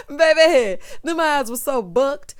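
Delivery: loud high cackle breaking into a near cry; helpless shrieking laugh. Real, not acted. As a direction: howl with laughter. Big laugh at Mark's eyes.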